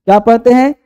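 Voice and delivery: normal talking voice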